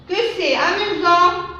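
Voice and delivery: high, delighted exclaiming; exclamation. Shown as a demonstration. Teacher demonstrates an exclamative sentence, high delight. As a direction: sound amazed at the fun. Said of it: "ce sont d'exclamations".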